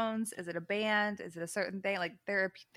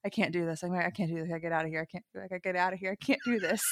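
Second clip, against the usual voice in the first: retold panicked muttering; 4 semitones lower, 5 dB quieter, faster than usual. Retelling friend's panicked refusal.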